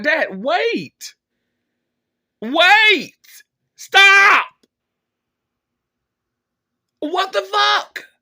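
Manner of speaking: desperate yell at the phone; exasperated shout. Retold or imitated. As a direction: cry out for him to stop, pitch climbing. Retold shouting at dad.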